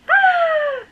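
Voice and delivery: high delighted squeal; short giddy high squeal; excited squeal. Real, not acted. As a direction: let out a fangirl shriek. Squeals over signed copy.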